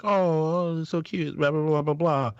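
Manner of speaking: high, dismissive imitation of gushing; coo, then breeze through the blahs; mock cooing. Crowd gushing over a proposal, high mock cooing.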